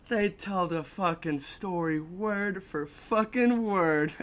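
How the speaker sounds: "word for fucking word" stretched out slowly; slow right down on "word for fucking word"; drawn-out emphasis. Real, not acted. Storyteller on the court's exact account, slow emphatic "word for fucking word".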